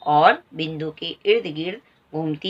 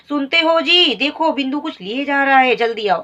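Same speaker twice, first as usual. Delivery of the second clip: high, louder urgent call; calling her husband; 6 semitones higher, 4 dB louder than usual. Acted. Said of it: "अपने पति नंदन को आवाज लगाती है".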